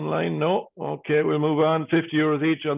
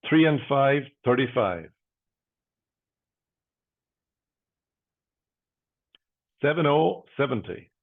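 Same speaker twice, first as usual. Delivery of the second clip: steady, rhythmic number calling; bingo caller. Acted. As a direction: call each number out, then pause.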